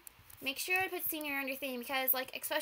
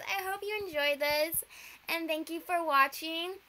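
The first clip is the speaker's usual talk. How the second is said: normal talking voice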